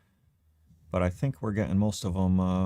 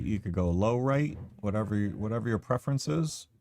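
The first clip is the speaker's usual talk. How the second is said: ordinary delivery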